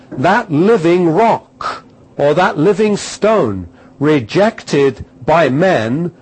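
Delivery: loud, rising preacher's declamation; full-force preaching. Acted. Preacher drives home his point to the congregation, loud and fervent. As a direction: proclaim it to the congregation.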